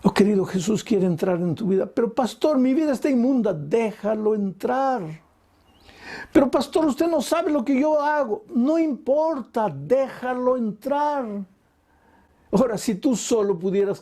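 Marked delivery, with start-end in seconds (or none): 1.9-3.5 higher, pleading doubter's voice; voiced sinner's objection. Sinner objects to the pastor, pleading higher. 3.5-11.2 high, slow, emphatic answer; slow preaching reply. Acted. Pastor urges the sinner, slow and emphatic.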